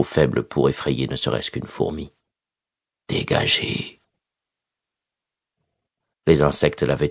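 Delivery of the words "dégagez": breathless whispered order; barely a whisper, drained. Acted. Weak repeated order to insects.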